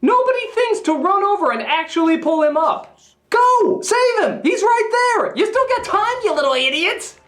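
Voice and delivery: high-pitched, frantic scolding; exasperated yelling. Acted. Critic yells at the Fellowship to rescue Gandalf, exasperated. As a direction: shout in mounting disbelief at useless bystanders. Urging onlookers to save Gandalf.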